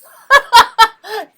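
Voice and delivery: big high laugh; loud burst of laughter. Real, not acted. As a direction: crack up loudly at the device.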